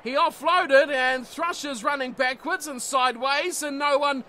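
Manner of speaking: loud, high commentary building to a shout; excited play-by-play. Acted. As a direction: call the break and the try at full pitch. Live call of breakaway try.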